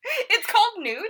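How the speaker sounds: high, loud, slowed disbelief; shocked high-pitched question; surprised squeak. Real, not acted. Surprised at friend's correction.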